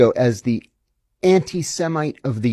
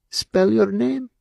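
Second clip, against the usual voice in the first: high, slow, stern Shin Bet officer; cold interrogator; 9 semitones higher, slower than usual. Retold or imitated. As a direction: drag it out like a suspicious interrogator. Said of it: "and he says".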